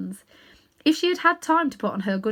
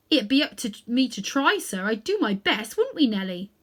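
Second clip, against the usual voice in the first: a London cabby fumbling for words; cockney cabby; 3 semitones higher than usual. Acted. Cabby answers the great lion, humble cockney voice.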